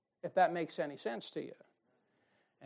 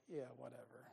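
dismissive mutter; 6 semitones lower, 20 dB quieter than usual. Retold or imitated. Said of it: "I'm like".

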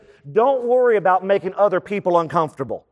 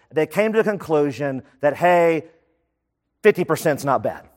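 ordinary delivery

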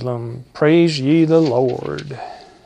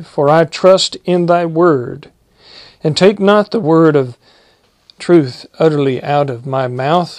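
louder, steady pulpit reading of the psalm; solemn King James reading voice; scripture reading; 5 dB louder than usual. Acted. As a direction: proclaim the verses to the church. Preacher reads long psalm passage.

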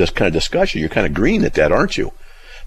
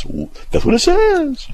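very high, drawn-out mock parroting; friend's mocking imitation. Friend mocks the teacher's catchphrase, mocking imitation. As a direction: mimic someone in a squeaky, stretched-out voice. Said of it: "my friend mocks me and goes".

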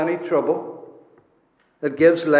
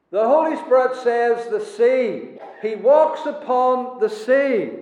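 raised pitch, weighty stress on "the sea"; emphatic stress; 6 semitones higher than usual. Real, not acted. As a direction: set "The sea" apart and drive it home, deliberately. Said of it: "I emphasize the words of the Holy Spirit".

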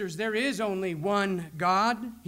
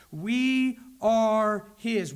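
"We are his" spoken slowly, louder, with "We" punched; stressed slowly; 4 dB louder, much slower than usual. Shown as a demonstration. Preacher driving home God's emphasis, slow and strong. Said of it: "That is God's own emphasis".

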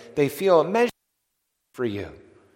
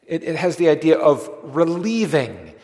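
normal talking voice

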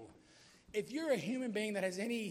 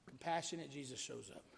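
softer and lower, tender; compassionate; 5 semitones lower, 5 dB quieter than usual. Shown as a demonstration. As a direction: bring it down gently after the big billing. Contrasts mighty and tender Jesus.

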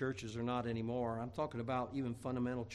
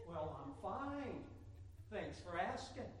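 higher, softer, drawn-out politeness; mock ladylike reply; 4 semitones higher, 9 dB quieter, slower than usual. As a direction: play a man pretending to be a lady. Answers dressed as a woman.

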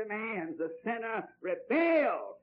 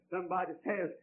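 ordinary delivery

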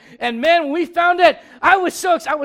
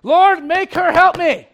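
higher, louder, slow demanding plea; whiny Martha; 5 semitones higher, 7 dB louder, slower than usual. Retold or imitated. Preacher mimicking Martha to the congregation, mock demanding whine. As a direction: nag the Lord like a put-upon sister. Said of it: "what does Martha do".

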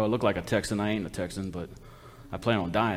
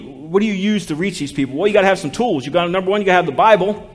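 normal talking voice

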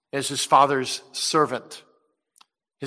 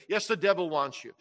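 ordinary talk, nothing marked

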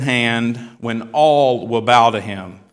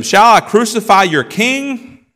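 loud, high, drawn-out question; challenging question; 10 semitones higher, 7 dB louder, slower than usual. Acted. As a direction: put it incredulously to the crowd. Pilate challenges the shouting crowd.

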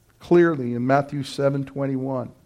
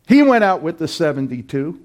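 usual speaking voice

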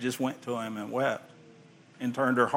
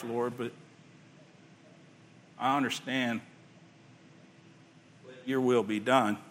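humble prayer. Pretend. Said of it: "You say".

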